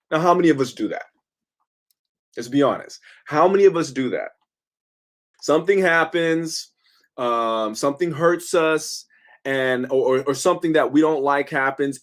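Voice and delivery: ordinary delivery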